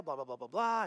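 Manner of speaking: tossed-off blah blah; breezy filler. Retold or imitated. Telling the pastor his gift, glossing over the words.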